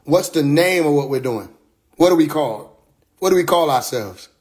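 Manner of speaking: ordinary talk, nothing marked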